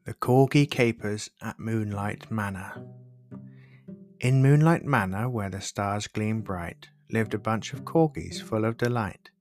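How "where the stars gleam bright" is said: usual speaking voice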